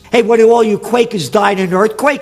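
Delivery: rising heated harangue; heated rant. Real, not acted. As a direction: shout down the preachers of lesser evil. Ranting at priests and voters.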